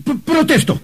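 high-pitched flustered protest; indignant protest. Acted. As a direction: squeal the objection in disbelief. Man protests he wasn't murdered.

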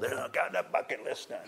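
strained old-timer voice, played for laughs; mock eighty-five-year-old. Preacher mimics elderly bungee jumper ticking off bucket list, mock old voice. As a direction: imitate an old daredevil after the jump. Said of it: "at eighty-five years old".

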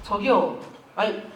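ordinary talk, nothing marked

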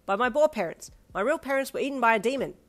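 forceful, tactless statement; blunt. Acted. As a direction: announce it with no softening. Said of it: "he said bluntly".

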